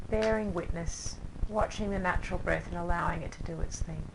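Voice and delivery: soft, unhurried voice with long pauses; calm yoga-class relaxation, leaving space after each phrase; guided meditation. Acted. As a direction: gently guide them down into rest and breath.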